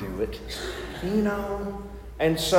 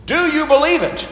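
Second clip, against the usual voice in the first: game show host; 10 semitones higher, 5 dB louder than usual. Pretend. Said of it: "my best game show voice".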